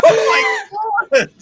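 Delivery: loud, very high drawn-out cry; over-the-top stunned exclamation; hammed-up shock. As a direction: shriek it slowly in amused astonishment. Own shocked reaction to killing.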